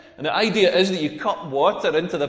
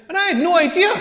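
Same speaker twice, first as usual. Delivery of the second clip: rising excited build; 10 semitones higher, 4 dB louder, faster than usual. Real, not acted. Building to the punchline.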